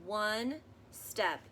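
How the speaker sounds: put-on gentle read-aloud for children, measured pace; story narration. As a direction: read it like a bedtime picture book. Reading picture book aloud.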